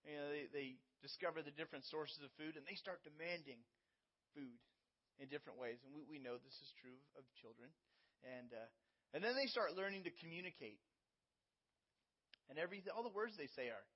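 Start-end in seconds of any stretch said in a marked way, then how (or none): none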